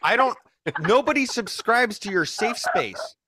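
mock outraged yell. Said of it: "that was my little piece".

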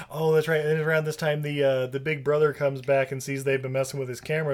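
ordinary delivery

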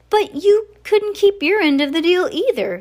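put-on high, loud little-child voice; baby Sunny's protest. A narrator's reading. Baby Sunny's protest, translated by narrator.

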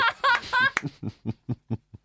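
high, giddy laugh after a slip; real embarrassed laugh; high burst of laughter. Real, not acted. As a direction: laugh at having said too much.

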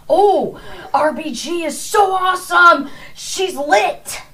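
high, loud gushing mockery; gushing fan voice. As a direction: squeal it like a giddy fan. Mocking a fan's gushing.

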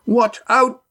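high-pitched urgent yell; warning yell. A narrator's reading. Astrea warns Red Ian of an attack, yelling. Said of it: "Astrea yelled".

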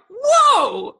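loud, high scandalized whoa; shocked reaction. Retold or imitated. Friends watching the teacher scene, shocked. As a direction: cry out in mock shock, pitch soaring. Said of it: "we watch it, we're like".

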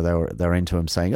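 usual speaking voice

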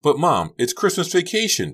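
put-on high, loud teen wail; anguished high-pitched whine. A narrator's reading. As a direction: wail in protest to mom. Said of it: "Becca wailed".

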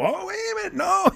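sudden high squeal of indignation; mock outrage. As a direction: jump way up in pitch, hammed-up offended yelp. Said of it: "not just go".

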